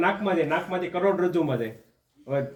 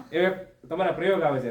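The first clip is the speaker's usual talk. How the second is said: ordinary talk, nothing marked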